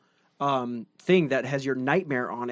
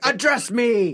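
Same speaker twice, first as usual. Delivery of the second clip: raised, put-on commanding voice; belittling gatekeeper; 5 semitones higher, 4 dB louder, slower than usual. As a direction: imitate an imperious game master demanding respect. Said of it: "He literally would belittle us".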